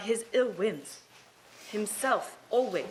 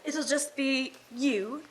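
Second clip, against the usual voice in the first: teasing; 2 semitones higher, slower than usual. Acted. Slow teasing list.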